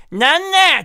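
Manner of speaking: high, loud Kumamoto granny; old farming granny. Retold or imitated. Granny asks receptionist where rakugo is, loud old-woman voice. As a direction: badger loudly like a hard-of-hearing old woman. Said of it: "そのおばあちゃんが".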